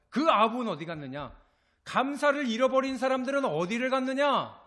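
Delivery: preacher's booming challenge, climbing high; loud demanding question. Acted. Jesus asks where the thankless went, loud rising demand.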